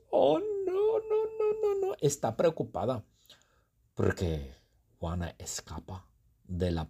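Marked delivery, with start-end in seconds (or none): 0.1-1.9 very high, squeaky panicked oh no. Acted. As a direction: squeal it like a frightened cartoon girl. Worried Juana cries out.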